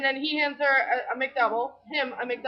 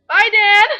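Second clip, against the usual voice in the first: higher and louder, almost shouting his name; loud eager goodbye; 6 semitones higher, 11 dB louder than usual. Retold or imitated. Teasing a flirting cashier, loud excited goodbye. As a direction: call it out brightly, over-eager. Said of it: "And I was like".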